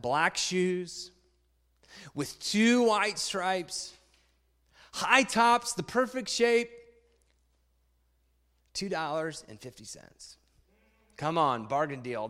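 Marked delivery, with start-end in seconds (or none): 0.0-10.4 put-on reading a children's book aloud to the congregation; story reading. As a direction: tell it like a picture book to a room.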